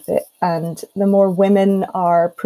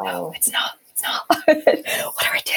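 hushed, whispered retort with a laugh; mock panicked whisper; 3 dB quieter than usual. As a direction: whisper it in playful disbelief. Acting out her stunned reply to teacher.

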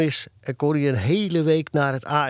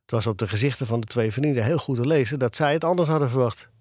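ordinary delivery

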